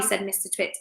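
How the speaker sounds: ordinary delivery, nothing marked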